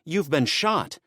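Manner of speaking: high, alarmed shout. Acted. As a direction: call out the warning. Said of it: "she shouted".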